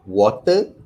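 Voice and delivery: water with the t crisp, British style. Shown as a demonstration. Lecturer demonstrating British water to students. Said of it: "the simplest word would be what".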